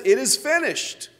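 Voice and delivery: high, loud triumphant declaration; victory cry. Retold or imitated. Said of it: "cried out a victory cry".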